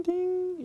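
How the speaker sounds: high sung "ding" like an elevator chime. Retold or imitated. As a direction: ring it out like the lift bell. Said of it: "it has a little ding".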